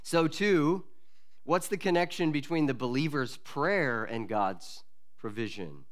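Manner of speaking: ordinary talk, nothing marked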